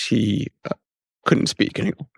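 real tearful near-whisper; choked up, crying. Real, not acted. Son recalling his dying father losing speech, choked up. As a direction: voice gives out as the tears come. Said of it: "you don't cry with anyone else".

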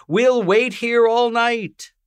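high, slow carrying call. A narrator's reading. Family calling after the boat, slow high call. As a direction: stretch it out toward the sea. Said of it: "we called".

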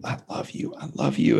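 soft whisper, tender; father whispering love to his son in the hospital bed. Retold or imitated. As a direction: breathe it out as a whisper. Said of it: "And then he goes".

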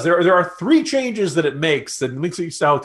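ordinary talk

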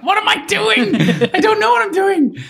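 high-pitched panicked squeal, half laughing; mock panic. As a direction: squeal it like a director suddenly out of his depth. Acting out his panic while directing.

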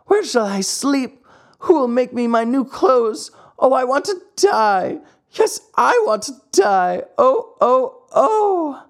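high, wailing puppet's grief; sobbing lament. A narrator's reading. Pinocchio mourning his dead fairy alone, sobbing lament. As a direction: plead and wail like a heartbroken child. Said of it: "As he sobbed, he said to himself".

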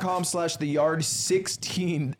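slow, deliberate URL read. Shown as a demonstration. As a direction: spell out the address slowly. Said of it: "say it".

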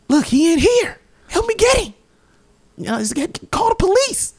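very high-pitched imitation of panic; high, comic cry for help; mock frantic plea. Retells naked man's frantic plea.

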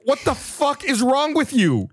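high-pitched, drawn-out outrage; exasperated mock scolding; retold fury. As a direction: re-enact an appalled telling-off, slow and high. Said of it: "that's exactly what it sounded like".

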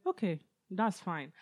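slow, deceptively calm parent; a hint of a parent's ominous okay; menacing calm. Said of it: "African parent... they'll say".

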